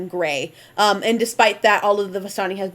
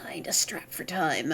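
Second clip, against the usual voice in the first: whisper; 4 semitones lower, 10 dB quieter than usual. Acted. NPC drops voice.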